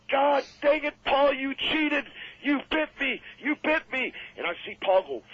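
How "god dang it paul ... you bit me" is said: high, outraged yell, slow at first; pained angry yell. Retold or imitated. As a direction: shout in pain that you were cheated. Tony yells after being bitten.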